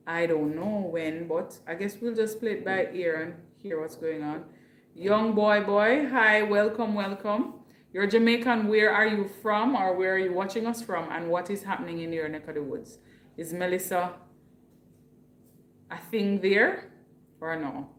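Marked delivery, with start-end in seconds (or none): none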